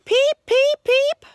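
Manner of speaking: very high, chirpy little peeps; frog peeping. A narrator's reading. Little frog calling through the woods, high chirpy peeps. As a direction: pipe it like a tiny spring frog.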